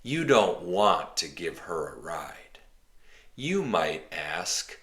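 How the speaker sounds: normal talking voice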